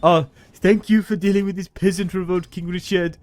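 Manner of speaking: put-on high, grateful French-accented voice; mock French accent. French noble thanking King Richard, high mock French. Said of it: "where all these accents are coming from".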